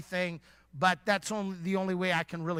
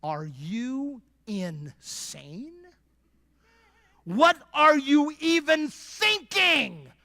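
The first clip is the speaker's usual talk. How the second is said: incredulous wife; 4 semitones higher, much slower than usual. Retold or imitated. Wife in bed turning down her husband, slowly incredulous. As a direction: stretch it out, appalled and incredulous. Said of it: "you're like".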